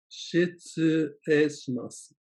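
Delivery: "Shitsurei shimasu" stretched out slowly; teacher slows "Shitsurei shimasu" down; drawn-out learner demo; slow model phrase. Shown as a demonstration. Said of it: "say these others slowly too".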